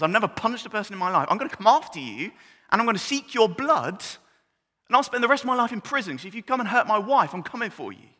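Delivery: loud, fast, fired-up preaching; preaching at full force. Real, not acted. Preacher warning anyone who would hurt his wife, loud and fast.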